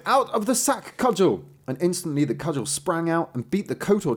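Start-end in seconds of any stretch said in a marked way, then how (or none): 0.1-1.4 high, ringing magic command; calling a command. A narrator's reading. Apprentice setting the cudgel on attackers, calling out. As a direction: call it out well above the speaking pitch.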